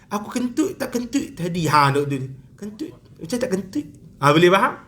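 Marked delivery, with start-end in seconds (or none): 0.1-1.7 soft, higher-pitched self-questioning; mock doubt. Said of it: "Kadang-kadang kita syok tu". 2.5-3.9 very soft, wavering self-question; mock doubt. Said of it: "Kadang-kadang kita syok tu".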